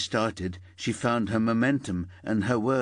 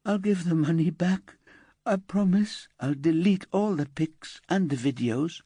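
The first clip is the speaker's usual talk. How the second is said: higher, tearful-edged pleading; pleading teenage girl; 9 semitones higher than usual. Acted. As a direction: beg them to understand. Elsa promises to undo it.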